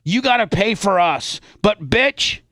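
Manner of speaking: normal talking voice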